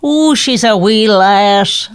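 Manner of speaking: slow, lilting Irish lady voice; Irish accent. Pretend. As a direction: put on an Irish lass accent. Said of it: "now do an Irish lady".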